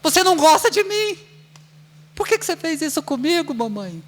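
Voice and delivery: put-on high, fast hurt child's voice; a child protesting the shot to mom; child complaining. Child protesting to mother after a shot, high and fast. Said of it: "Falaria o que pra você?".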